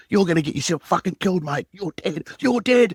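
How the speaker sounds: high, loud furious screaming; screaming at him. Retold or imitated. Screaming down the phone at an anonymous caller, furious. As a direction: scream the threat at him, top of the voice. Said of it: "And I started screaming at him and yelling at him".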